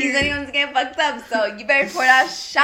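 loud party cheer; excited shout. Real, not acted. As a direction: hype up the shot. Friends cheering before a shot.

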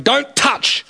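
high-pitched warning, no real volume; retold yell at a little kid; mock parental yell. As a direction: imitate a father's sharp telling-off. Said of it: "yelling at me, going".

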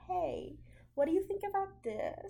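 high, tentative people-pleasing question; timid, hesitant asking. Retold or imitated. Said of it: "you would say it like that".

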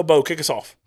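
normal talking voice

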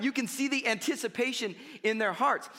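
ordinary delivery

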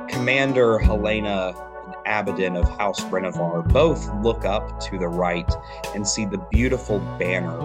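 usual speaking voice

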